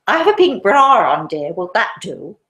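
put-on drunken posh lady. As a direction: call out brazenly as a tipsy cultured lady. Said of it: "the drunken woman says".

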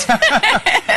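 sudden high-pitched laugh; high burst of laughter. Real, not acted. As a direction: laugh out loud at the joke. Laughs at blooper joke.

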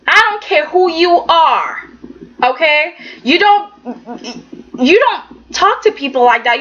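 ordinary talk, nothing marked

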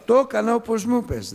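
high and loud insisting; protesting child. Retold or imitated. Boy lying to his father about the water, high loud protest. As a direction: sell the lie, pitching the voice up.

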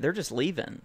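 ordinary talk

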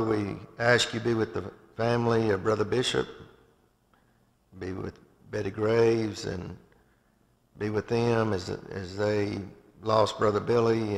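ordinary talk

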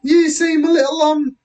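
high British lady officer, slowing down; British policewoman. Acted. Policewoman to flustered boy, high British voice. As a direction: choose the word delicately in high British tones. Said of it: "the police officer's British, but she is now".

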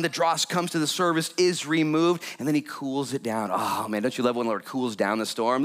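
ordinary talk, nothing marked

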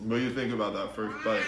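talking through hand; muffled mid-chew speech; mouth full chewing. Real, not acted. Seen 0: Man in cap keeps hand at mouth, chewing, burger in hand.

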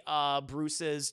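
ordinary talk, nothing marked

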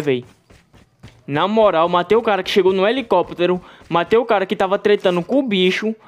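ordinary delivery

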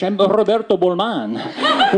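lilting Spanish accent, slow and sing-song. Shown as a demonstration. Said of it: "sing-songy cadence of Spanish".

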